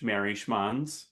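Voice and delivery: ordinary talk, nothing marked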